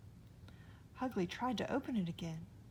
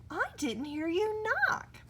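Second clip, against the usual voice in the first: high, slow mother's voice; a hint of a surprised mother; mother voice, lightly voiced; 12 semitones higher, 5 dB louder than usual. People Mother catches them.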